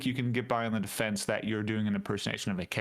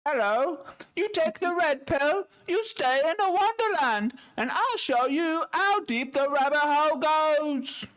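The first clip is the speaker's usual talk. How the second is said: put-on much higher, louder, slow posh English accent; a hammed-up English gent proclaiming; Englishman impression; 5 dB louder than usual. Morpheus line as an over-the-top English accent. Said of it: "your Englishman impression is so specific".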